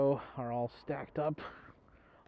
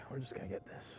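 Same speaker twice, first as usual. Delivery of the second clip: whisper; 2 semitones lower, 12 dB quieter, faster than usual. Real, not acted. Whispering inside the store.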